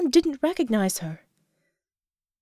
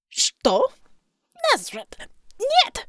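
spluttering; 11 semitones higher, slower than usual. A narrator's reading. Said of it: "Savior spluttered".

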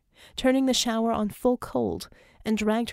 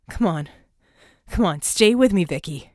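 urgent, louder plea to stay awake; urgent pleading; 2 semitones lower, 8 dB louder than usual. A narrator's reading. Red urging dying Vicky to hold on, loud and urgent. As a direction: push hard, begging her to stay.

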